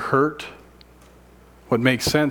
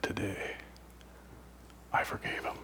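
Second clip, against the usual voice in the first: whispered, softer; hushed confession; 15 dB quieter than usual. Retold or imitated. Inmate telling the men he forgave, whispered. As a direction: let it fall to a breath.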